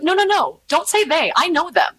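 high, insistent "no, no, no"; mock indignant defence of friends; firm correction. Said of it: "I'm like".

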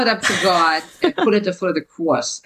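imitated Jersey accent, broad AW vowels; mother's Jersey accent. Author repeating her mother's saying for the host, Jersey accent. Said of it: "I spelled it out phonetically".

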